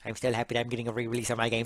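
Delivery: normal talking voice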